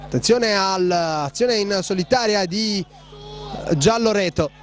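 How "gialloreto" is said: normal talking voice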